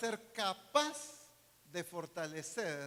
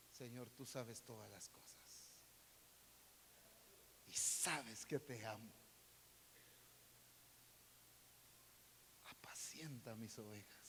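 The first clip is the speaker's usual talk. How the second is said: hushed intimate answer; 9 semitones lower, 14 dB quieter than usual. Acted. Peter's third answer, then Jesus.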